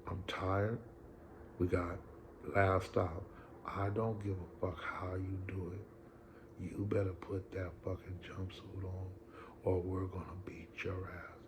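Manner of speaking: hushed, menacing whisper into his ear. Retold or imitated. Sergeant pulling the inmate aside, whispered threat. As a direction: lean in close and threaten in a whisper. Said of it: "whisper in my ears".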